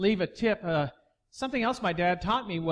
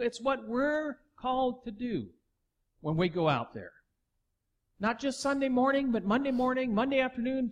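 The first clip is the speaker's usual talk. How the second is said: full-force preaching; 6 semitones higher than usual. Acted. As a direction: proclaim it to the whole church. Preaching continues.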